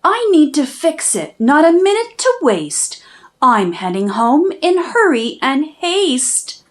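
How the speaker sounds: ordinary delivery, nothing marked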